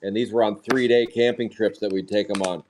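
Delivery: normal talking voice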